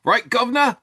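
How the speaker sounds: high, loud Cockney-style "Right, governor?"; a silly Cockney chirp; mock Cockney accent. Co-host capping his bad English accent joke. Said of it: "my English accent".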